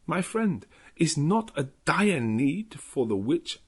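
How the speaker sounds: calm the frightened husband, gently but firmly; Van Helsing soothing Jonathan in Dutch accent; calming clear tones. Acted. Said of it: "clear, sweet tones which seemed to vibrate".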